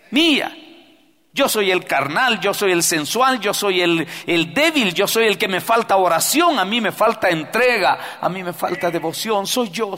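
loud, high preaching confession; full-force preached repentance; fervent confession prayer. Acted. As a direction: cry out your own guilt to God.

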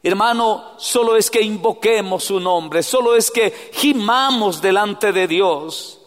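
loud, high preacher cry; preaching at full force. Acted. Preacher urging the congregation to cry out to God, loud and high. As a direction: lift it into a pleading call.

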